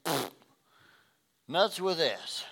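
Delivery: scoffing, giving up on it; dismissive pfft. Retold or imitated. Believer fed up with God, scoffing dismissively. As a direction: blow it off with a scoff. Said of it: "It's awfully easy to say".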